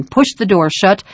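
ordinary delivery, nothing marked

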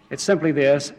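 ordinary talk, nothing marked

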